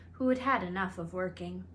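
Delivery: ordinary delivery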